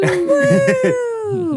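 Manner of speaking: high shouted woo; excited whoop. Real, not acted. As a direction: whoop loudly for the guest. Cheering guest welcome.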